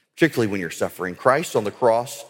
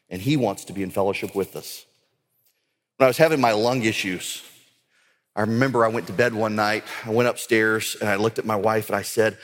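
ordinary talk

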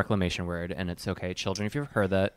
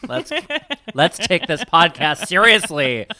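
mock serious; 8 semitones higher, 9 dB louder, slower than usual. Laughing mock-stern correction.

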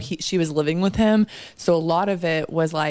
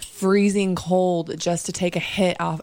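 ordinary delivery

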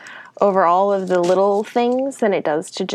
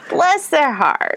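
put-on Southern accent; 3 semitones higher, slower than usual. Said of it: "Southern accent that doesn't exist".

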